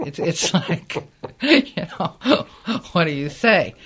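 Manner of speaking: real laughter breaking into the words; amused disbelief, laughing as you talk; laughing helplessly. Real, not acted. Laughs at her own awkward predicament.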